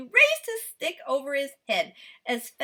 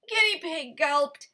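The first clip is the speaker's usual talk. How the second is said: plain reading voice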